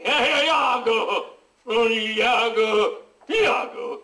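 slow, drawn-out tragic call; milk each Iago like a stage tragedian; dramatic call. Acted. (richiamo tragico e strascicato)

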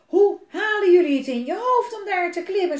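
put-on father screaming at his children; shouting. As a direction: yell at the kids in fury. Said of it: "schreeuwde papa".

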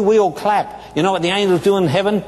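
ordinary delivery

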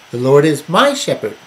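"my" punched above the other words; emphatic "my" before shepherd; stress on MY. Shown as a demonstration. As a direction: lean hard on the word "my". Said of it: "emphasize different words to bring out".

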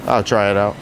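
ordinary talk

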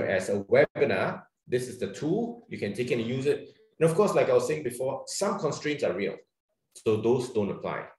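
ordinary delivery

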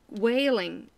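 ordinary talk, nothing marked

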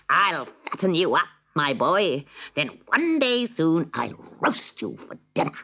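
slow, high, relishing witch voice; greedy witch. Acted. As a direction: savour every word of the threat. Witch threatens Hansel.

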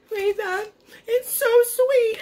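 very high, tearful squeak; mock bawling. Wife retelling how she answered her worried husband, high mock-weepy wail. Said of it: "I just started bawling".

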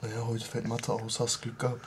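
soft hushed whisper. Real, not acted. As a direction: whisper so he stays asleep. Whispering to sleeper.

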